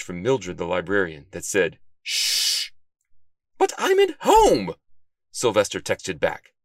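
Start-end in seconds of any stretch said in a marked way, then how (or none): 2.0-2.7 long librarian's "shh"; sharp, drawn-out hush; stern shushing. A narrator's reading. Librarian glaring at the noisy detective, shushing sternly. 3.6-4.7 high, loud, drawn-out objection; indignant protest. A narrator's reading. Detective shushed in his own house, indignant.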